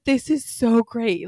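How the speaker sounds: gushing bride. Retold or imitated. Bride hearing about her cousin's admirer, gushing. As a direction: gush slowly with playful delight. Bride's delight acted in retold story.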